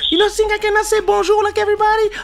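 high, loud, fast falsetto indignation; mock offended complainer. Mocking a man offended by a greeting, high, loud and fast. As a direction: rant it in a squeaky mock-outraged voice.

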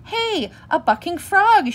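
high, loud, drawn-out cub shout; excited shout. A narrator's reading. Brother bear spotting the bucking frog, shouting. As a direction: shout it like a thrilled little boy. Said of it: "shouted Brother".

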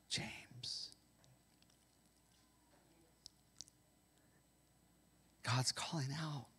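hushed whispered name; whispered call. Pretend. God whispering a listener's name.

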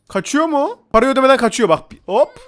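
sudden high alarmed yell; high, loud startled cry; startled shout. Real, not acted. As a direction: shout after someone fleeing. Customer runs off without paying.

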